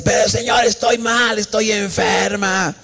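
high, drawn-out whining plea; mock wail back at the Lord; protesting sick woman. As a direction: imitate her arguing in a high cry. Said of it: "tan grave que le contestaba".

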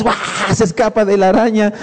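ordinary talk, nothing marked

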